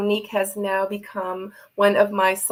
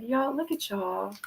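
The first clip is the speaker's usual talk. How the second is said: ordinary delivery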